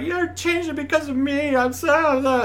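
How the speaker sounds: high, wailing mock-sob; mock sobbing. Actor blaming himself for the rewrite, mock-sobbing wail. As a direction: whimper it like a guilt-stricken wreck, half-joking. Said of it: "I was, like, crying and sobbing".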